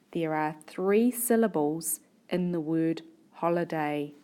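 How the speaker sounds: ordinary delivery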